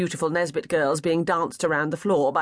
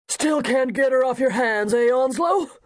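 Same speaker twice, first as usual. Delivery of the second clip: higher, louder hearty jibe from a red-faced gentleman; teasing bluff guest; 7 semitones higher, 4 dB louder than usual. Acted. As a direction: nudge him with a loud, jolly taunt. Red-faced guest ribbing his host.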